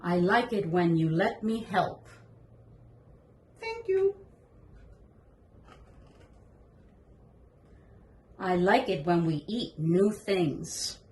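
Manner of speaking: slow gentle read-aloud voice; reading to a toddler. Acted. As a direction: read it slowly to a small child. Reads the board book aloud.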